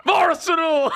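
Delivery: imitating a loony Arsenal shout; mock fan yell. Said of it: "sitting there like a complete lunatic".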